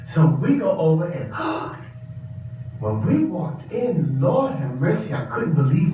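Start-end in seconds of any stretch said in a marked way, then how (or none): 1.4-2.7 sharp unvoiced gasp of shock; breathless gasp at the sight. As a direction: suck the air in, stunned. Shock on entering the bar.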